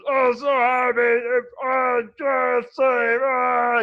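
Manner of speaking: loud, high blubbering troll joy; sobbing troll. Acted. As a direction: babble through happy tears. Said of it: "mumbling and sobbing incoherently".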